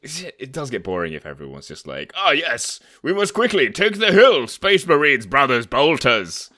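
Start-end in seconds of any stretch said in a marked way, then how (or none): 2.2-6.5 hammed-up British imperial officer, high and loud; rally the troops in a parody British accent. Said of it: "a British imperial citizen".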